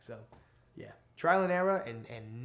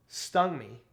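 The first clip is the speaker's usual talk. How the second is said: ordinary delivery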